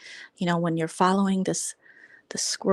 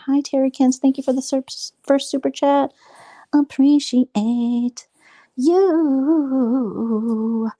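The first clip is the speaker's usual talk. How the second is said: high, loud gushing thanks to a donor; excited thanks; 7 semitones higher, 5 dB louder, slower than usual. Real, not acted. Streamer thanking a viewer for first super chat, excited. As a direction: gush your thanks, bright and up.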